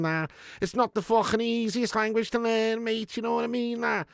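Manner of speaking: thick, hammed-up Scouse accent. Streamer mocking a viewer's Scouser jibe, thick Scouse accent. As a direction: put on a mouthy, spittle-filled Scouser. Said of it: "like watching a Scouser trying to speak English".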